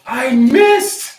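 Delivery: high, loud wail; loud lament. Real, not acted. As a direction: cry it out in dismay. Comic lament over dice roll.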